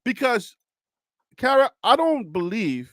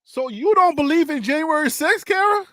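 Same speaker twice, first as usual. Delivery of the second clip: teasing laugh; 7 semitones higher than usual. Real, not acted.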